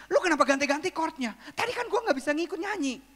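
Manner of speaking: imitated scolding, pitched high; angry pastor. Pastor scolding the keyboardist over changed chords, high and cross. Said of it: "tiba-tiba pendetanya marah".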